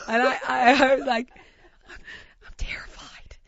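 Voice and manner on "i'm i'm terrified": unvoiced scared whisper. At the bar, confessing fear to Heidi, scared whisper. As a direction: breathe it so the chef can't hear.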